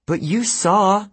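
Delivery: high, drawn-out insistence; insistent. A narrator's reading. Annabeth presses Percy about what he saw, insistent. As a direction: press the point, stretching it out. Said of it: "Annabeth insisted".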